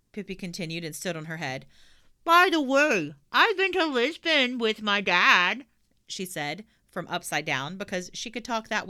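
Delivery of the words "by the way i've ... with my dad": high, loud slow boast; proud little girl; cheeky girl voice. Acted. Pippi boasts upside down.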